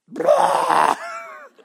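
loud burst of laughter; real loud laugh; big laugh. Real, not acted. Laughs recalling the teacher.

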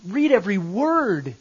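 "word" stretched long, loud and swooping; stress on word. Shown as a demonstration. Teacher showing students emphatic reading, stress on "word". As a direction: read it slowly, leaning hard on "word". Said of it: "read by emphasizing every word".

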